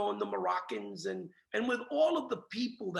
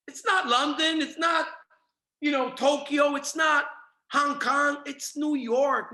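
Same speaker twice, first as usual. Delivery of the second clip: fired-up shout, higher and louder; 4 semitones higher, 5 dB louder than usual. Real, not acted. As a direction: belt it out like a rally cry. Rallying crowd, list of cities.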